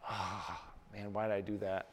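regretful sigh. Retold or imitated. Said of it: "and go".

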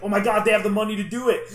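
high, loud, fast gushing; thrilled fan. Retold or imitated. A fan seeing the movie budget, thrilled fast gush. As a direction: gush like a fan who can't believe it. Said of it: "you're like".

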